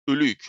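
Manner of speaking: ölü yük in Turkish pronunciation; Turkish term. Shown as a demonstration. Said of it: "in Turkish".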